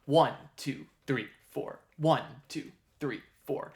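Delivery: even count, stress on "one" and "three"; counting in four. Shown as a demonstration. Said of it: "The stress is on one and three".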